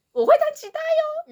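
high, cutesy sing-song boast; cute boasting line. Acted. The boy offering to join the show, cutesy high boast. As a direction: play the sweet boy showing off. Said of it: "然后是受说".

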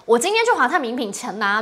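ordinary delivery

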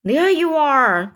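storybook cry of vindication. A narrator's reading. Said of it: "cried the chief of police".

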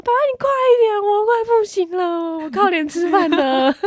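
high, loud, slowing whiny plea; hammed-up desperate pleading; panicked whine. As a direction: beg dad to hurry, dramatically. Begging dad to hurry.

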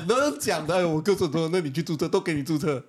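agitated protest away from the mic; far from mic. Real, not acted. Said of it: "你离麦克风太远了，你冷静一点".

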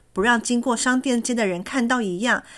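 ordinary delivery

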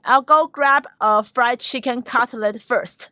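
slow, careful English example; slow model sentence. Shown as a demonstration. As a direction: read it slowly for learners. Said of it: "这时候你就可以说啊".

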